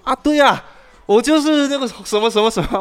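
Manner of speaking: high, loud, eager caller agreeing; mock the fake classmate's eager yes; scam caller. Fake classmate agrees on phone, high eager mock voice.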